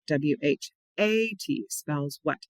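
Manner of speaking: slow spelling with a long, emphasized "A"; letter names paced out, weight on "A"; stress on A. Shown as a demonstration. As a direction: spell slowly, holding the "A". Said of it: "I emphasize the A".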